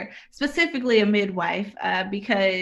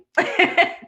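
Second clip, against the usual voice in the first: breathy self-mocking laugh; 9 semitones higher, 6 dB louder than usual. Real, not acted. Laughing at own irony.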